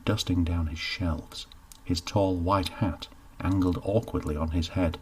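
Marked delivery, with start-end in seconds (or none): none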